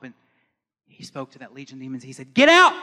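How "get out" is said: explosive high-pitched order, full force; commanding shout. Retold or imitated. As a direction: shout it to drive demons away. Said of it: "He shouted".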